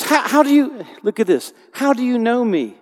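high, loud astonished cry; totally shocked. Retold or imitated. Nathanael stunned that Jesus knows him, high shocked cry. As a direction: gasp it out in disbelief. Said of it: "totally shocked".

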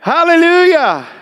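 preacher crying out loud to the Lord in prayer; fervent cry. Real, not acted. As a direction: cry it out to the Lord at full force. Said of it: "just say".

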